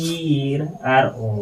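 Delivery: ᱤᱨ ᱟᱨ ᱚ drawn out, one letter at a time; teacher spelling ᱤᱨ ᱟᱨ ᱚ very slowly. Shown as a demonstration. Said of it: "ᱚᱱᱟ ᱫᱚ ᱨᱚ ᱦᱩᱭᱱᱟ". (ᱤᱨ ᱟᱨ ᱚ ᱟᱹᱰᱤ ᱞᱟᱦᱟᱹ ᱞᱟᱦᱟᱹ ᱩᱪᱪᱟᱨᱚᱱ)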